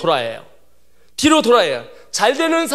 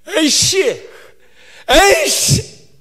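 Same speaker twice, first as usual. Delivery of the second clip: high, loud mock crying curse; escalating wail of frustration, acted out; hammed-up sobbing swear; tearful outburst; 9 semitones higher, 6 dB louder, much slower than usual. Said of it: "막 이러고 울었어요".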